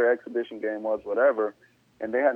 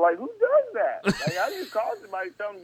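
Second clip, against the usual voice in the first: outraged; 10 semitones higher than usual. Real, not acted. Player venting about team's reversal, outraged high disbelief. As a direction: stretch it out in disbelief.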